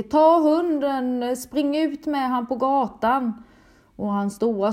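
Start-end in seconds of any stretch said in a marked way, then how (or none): 0.0-3.4 loud, much higher, drawn-out shout; desperat skrik. Retold or imitated. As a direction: yell to the kids to save the dog. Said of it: "och jag skriker". (högt, utdraget desperat rop till barnen)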